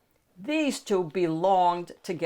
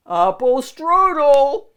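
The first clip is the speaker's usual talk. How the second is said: loud, slow, higher call of the magic words; 8 semitones higher, 12 dB louder, slower than usual. Acted. Shrek trying the magic words on the donkey, loud slow call. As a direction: belt out "Apple strudel!" with a rising "strudel". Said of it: "tried the magic words".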